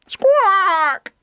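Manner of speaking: one long, piercing squawk; very high, loud bird cry; parrot squawk. Acted. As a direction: screech like a noisy parrot. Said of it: "Pedro's squawks".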